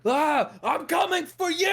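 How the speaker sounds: high, loud battle yell; charging guard shout. Acted. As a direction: scream as he charges. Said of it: "and he's like".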